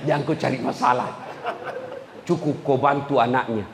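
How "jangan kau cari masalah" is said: fast, laughing through the warning; laughing repeat of the warning to husbands. Real, not acted.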